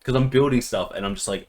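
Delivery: usual speaking voice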